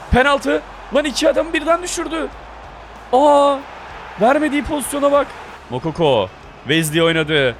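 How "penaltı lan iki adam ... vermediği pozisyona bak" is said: excited yelling. Real, not acted. Player yelling at the game as his striker is fouled, excited. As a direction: yell it out as the foul happens. Penalty chance in football game.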